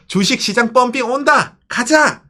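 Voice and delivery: high, excited rallying cry; mock-excited call to pile in; eager investors. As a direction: imitate investors hyped to buy. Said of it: "이랬던 사람들이".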